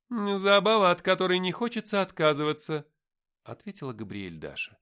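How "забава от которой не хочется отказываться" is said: higher, louder, assured angel speaking; angel Gabriel's voice. Acted. Angel defending Lucifer to the men, high and loud. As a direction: speak as a proud, certain angel.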